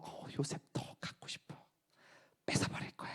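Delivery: hushed breathy whisper of a jealous woman; scheming whisper. Retold or imitated. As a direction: whisper it like a secret craving. Wife's secret desire voiced. (속삭이듯 은밀하게)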